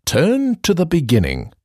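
usual speaking voice

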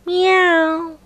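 put-on high meow at the old level; a content cat coming down. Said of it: "said Tabby".

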